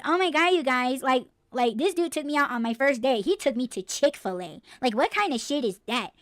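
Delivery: high, whiny valley-girl mock of a disappointed date; mocking entitled woman. Host mocks a woman griping to friends about her date, high whiny voice.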